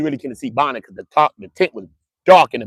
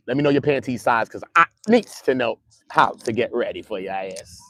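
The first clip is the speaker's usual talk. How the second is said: ordinary talk, nothing marked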